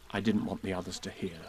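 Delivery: quiet aside to Rabbit; hushed. A narrator's reading. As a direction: say it low and soft so others can't hear. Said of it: "whispered to Rabbit".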